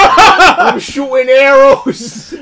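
slow, loud, laughing hero voice; laughing mock hero. Mocking Green Arrow, slow loud laughing hero voice. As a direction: ham up an archer hero through laughter. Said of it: "Hey, I'm walking in".

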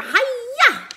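high, loud martial-arts cry; karate shout. Acted. Second sister slapping the dragon's chin, loud high hi-ya. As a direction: slap it out like a kung-fu kick. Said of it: "and shouted".

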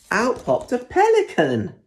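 spring the surprise; big reveal. A narrator's reading.

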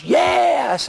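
a high, eager mock "Yes" from the crowd; mock audience yes. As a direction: toss out a fervent congregant's "Yes". Audience responding to TV preacher.

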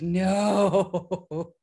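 long drawn-out, loud mock wail; whiny protest. Submissive told she stays heightened all day, whiny mock protest. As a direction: wail it out in hammed-up dismay.

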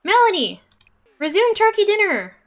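high, loud hurried orders; exasperated high calling out; frantic urgency. Real, not acted. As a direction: bark orders at a misbehaving Sim. Frantic with game chaos.

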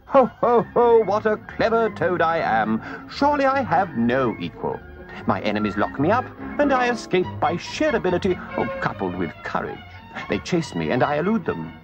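strut and brag with a laugh; conceited boasting. A narrator's reading. Toad boasts aloud to himself, swollen with conceit. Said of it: "pride and conceit began to swell".

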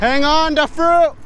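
loud high call across the yard; calling from far away. Real, not acted. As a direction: shout it out to someone far off. Yelling at dog across distance.

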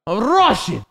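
high mock Russian accent; arguing Russian. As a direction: announce it as if in a street argument, Russian accent. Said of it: "a street argument".

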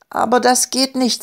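high-pitched, mildly desperate protest. A narrator's reading. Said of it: "sagte Elli verzweifelt". (leicht verzweifelt, mit hoher Stimme)

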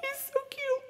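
put-on squeaky baby voice. Cooing over villager.

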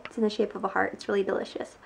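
soft late-night whisper; whispering so as not to wake anyone. Real, not acted. Tired late-night hotel room.

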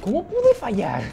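can't believe the miss; dismayed disbelief. Real, not acted. Gamer lamenting his missed throw, disbelieving.

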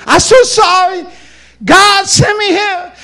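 very high, loud, drawn-out wail; exaggerated crying shout, words stretched; mock squalling. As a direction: bawl it out like a man boo-hooing. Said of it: "here's what he's crying".

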